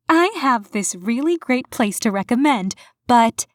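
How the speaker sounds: lightly voiced chatty young receptionist; bright friendly young woman. A narrator's reading. Erica voiced in the story.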